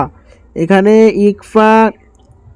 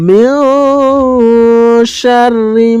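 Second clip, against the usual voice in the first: slow, higher melodic tajweed chant; Quran recitation; 3 semitones higher, much slower than usual. Shown as a demonstration. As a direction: recite it slowly, stretching the vowels. Teacher recites verse lines. (ধীর সুরেলা তিলাওয়াত)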